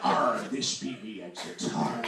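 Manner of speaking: low, loud pirate growl. Acted. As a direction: play a hammed-up pirate at the wheel. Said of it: "offended by that voice".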